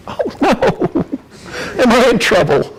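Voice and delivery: half-joking dread; mock panic. Consultant realizing the fix won't work, laughing panic.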